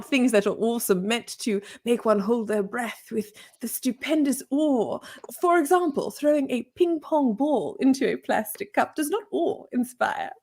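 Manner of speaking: pedantic mock-English lecture; British accent. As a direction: explain it like a condescending Brit. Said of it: "She did British accents".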